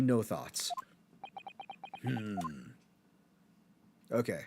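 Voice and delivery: ordinary talk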